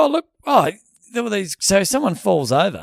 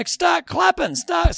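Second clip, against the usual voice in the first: high hyped-up MC command; rev up guy; 12 semitones higher, 3 dB louder, slower than usual. Retold or imitated. Imitating the hype man ordering the crowd, high MC call. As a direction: bark it like an arena announcer. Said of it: "And they go".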